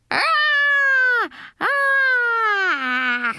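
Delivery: high, drawn-out screech; long piercing child's scare-scream. A narrator's reading. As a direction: shriek to scare someone. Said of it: "I screeched".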